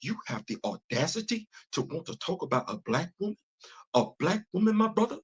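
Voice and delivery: indignant orator, softly spoken with rising pitch; Doctor Umar Johnson. Acted. Imagining an activist scolding an interviewee, mock-indignant. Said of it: "by Doctor Umar Johnson, but I know how that was gonna go".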